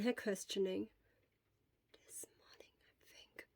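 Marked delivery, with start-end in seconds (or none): 2.1-3.5 put-on faint breathless whisper of a sick boy; almost no voice at all; barely audible whisper. Feverish boy whispers an answer to the nurse. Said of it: "we can barely hear you".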